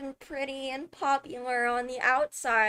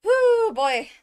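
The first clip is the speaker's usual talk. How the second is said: high, loud burst of excitement; excited whoop; 11 semitones higher, 9 dB louder than usual. Real, not acted. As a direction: let out a thrilled whoo, much higher and louder. Thrilled exclamation about upcoming update.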